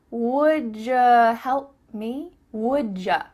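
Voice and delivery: very slow "wood-juh" for "Would ya"; drawn-out syllable-by-syllable demo; ja sound. Shown as a demonstration. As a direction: stretch "Would ya" to show the j.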